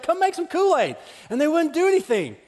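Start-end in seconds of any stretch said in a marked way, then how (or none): 0.0-1.0 put-on high, pleading little-kid whine; child begging siblings; whiny kid. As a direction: nag them for Kool-Aid. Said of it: "I was like".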